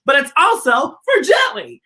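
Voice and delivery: high, loud burst on Jelly; excited. Acted. Host springing a joke on the kids, excited. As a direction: blurt it out with glee.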